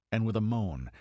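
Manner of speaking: ordinary delivery, nothing marked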